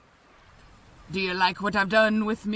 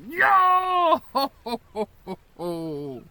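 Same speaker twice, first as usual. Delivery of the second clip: pirate laugh; 4 semitones higher than usual. Acted.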